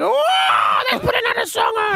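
high, hammed-up party holler; mock drunken yell. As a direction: shout it like a tipsy reveller. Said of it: "you'd have two breezes and be like".

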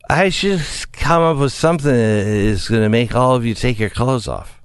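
put-on slurred, sloppy drunk talk. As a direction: slur it like you're hammered. Acting drunk politician quotes.

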